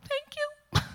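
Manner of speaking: high and delighted; high grateful reply. Retold or imitated. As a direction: lift the voice way up in giddy gratitude.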